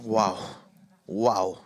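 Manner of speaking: low breathless wow; winded after the intense finish; out of breath. Real, not acted. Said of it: "It feels like I gotta catch my breath now".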